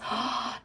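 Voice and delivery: quiet breathy gasp of being moved; hushed gasp; soft gasp. Real, not acted. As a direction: catch your breath, touched. Touched by a viewer's comment.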